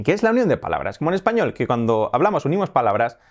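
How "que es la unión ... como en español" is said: ordinary delivery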